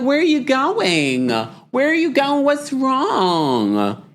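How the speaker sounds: high, slow, sugary mock concern; fake concern. Host imitates Tamra's phony concern for Katie, high and slow. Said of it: "Tamra wants to act all fake concerned".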